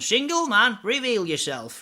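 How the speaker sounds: high, loud, slow showman call; mimicking the host on a dating game show; Paddy McGuinness impression. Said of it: "Paddy McGuinness going".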